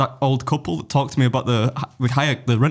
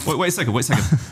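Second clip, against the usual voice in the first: ordinary talk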